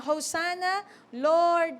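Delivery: Hosanna and Lord called out high and loud; crowd's cry. Acted. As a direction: lift the cry like a crowd hailing a king. Crowd crying to the King.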